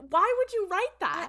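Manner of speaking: high, loud exasperated protest. Real, not acted. Podcaster protesting the show's writing, exasperated. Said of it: "I can't get mad at this".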